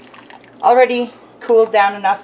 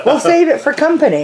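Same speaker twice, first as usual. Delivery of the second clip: laughing; 7 semitones higher, faster than usual. Real, not acted. Shared joke, both laughing.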